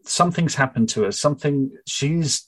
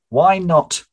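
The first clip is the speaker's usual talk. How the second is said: sharp question; 7 semitones higher, 5 dB louder than usual. Acted. Charlotte questions Faye.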